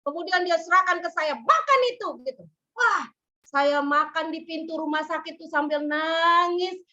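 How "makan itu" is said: curt order. Retold or imitated. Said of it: "marah-marah".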